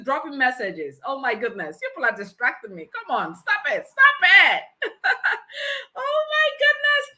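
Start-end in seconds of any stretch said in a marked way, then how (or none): 2.9-4.6 mock protest rising to a loud laugh; playful scolding. Host to distracting commenters, playful laughing protest. 4.7-5.9 high-pitched peal of laughter; helpless laugh. Real, not acted. Host cracking up at the comments, helpless laugh. 6.0-7.0 very high, squeaky laughing exclamation; overcome with laughter. Real, not acted. Host tickled by distracting commenters, laughing squeal.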